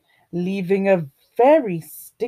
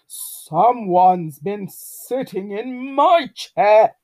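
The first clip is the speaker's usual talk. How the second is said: loud, slow bear growl; growling Daddy Bear; 5 dB louder, slower than usual. A narrator's reading. Daddy Bear finds his chair used, loud slow growl. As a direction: growl it out as an indignant papa bear. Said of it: "growled Daddy Bear".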